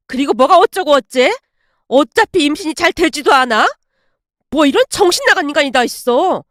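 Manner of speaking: angry, emphatic telling-off; furious shouting. Acted. Mother berating daughter-in-law over buying the dream, angry. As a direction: scold hotly in indignation. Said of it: "엄마가 불같이 화를 내고야".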